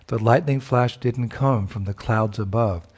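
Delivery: ordinary talk, nothing marked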